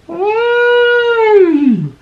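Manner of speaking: very high, trumpeting mock elephant hum; toy-like elephant call for kids; elephant sound. Shown as a demonstration. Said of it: "That's a sound that elephants make".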